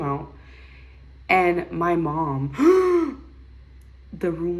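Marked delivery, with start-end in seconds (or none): 2.6-3.2 loud, high mock gasp; shocked gasp. Mom seeing the baby's head, shocked gasp. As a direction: suck in air in astonishment.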